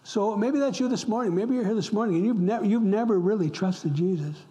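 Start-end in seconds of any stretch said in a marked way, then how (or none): none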